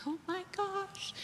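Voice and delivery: breathless high hushed gasp of disbelief; stunned whisper. Retold or imitated. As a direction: let it slip out high and hushed, overwhelmed. Overwhelmed at the healing service.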